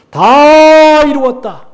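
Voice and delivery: loud, high, long-drawn proclamation; triumphant cry. Acted. Preacher quotes Jesus on the cross, triumphant cry. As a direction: stretch the first word out in a big ringing cry.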